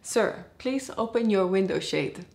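ordinary delivery

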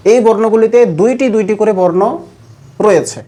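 normal talking voice

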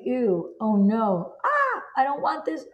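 rising, squeamish "ew, oh no" recoil; dismayed inner voice. Pretend. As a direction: recoil at something you don't want, pitch jumping up.

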